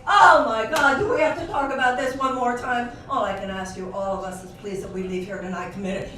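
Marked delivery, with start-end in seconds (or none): 0.1-2.9 much louder, higher groaning complaint; fed-up listener groaning about Assange again, loud; mock exasperation. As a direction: whine it like a fed-up guest. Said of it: "if they say".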